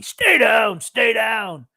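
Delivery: loud trainer shouting stay down; Mickey from Rocky. Retold or imitated. Commentator imitating Mickey yelling at Rocky from the corner. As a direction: bark it like a boxing trainer in the corner. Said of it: "It was like Mickey in, you know, Rocky".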